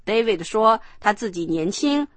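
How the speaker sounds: ordinary talk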